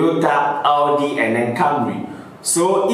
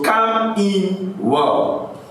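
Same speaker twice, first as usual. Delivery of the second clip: very slow, drawn-out, higher-pitched phrase; deliberate enunciation; 6 semitones higher, much slower than usual. Shown as a demonstration. Explaining rap wordplay to viewers, slow deliberate emphasis. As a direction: stretch each word out slowly for the listener.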